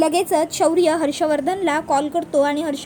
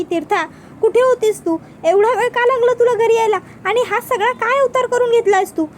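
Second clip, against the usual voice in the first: raised, louder voice of a worried mother; higher, urgent motherly demand; alarmed questioning; 6 semitones higher, 4 dB louder than usual. A narrator's reading. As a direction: fire anxious questions, pitched up. Alarmed mother questions returning daughter.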